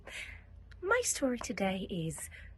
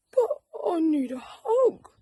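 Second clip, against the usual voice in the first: pleading; 10 semitones higher, 5 dB louder than usual. Acted. Bear pleads with rabbit.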